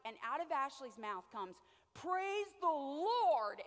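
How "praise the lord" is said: slow praise. Retold or imitated. Ashley's awed stunned reply.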